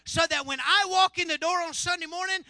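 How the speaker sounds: loud, high, driving sermon; full-force preaching. Acted. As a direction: preach it out to fill the room.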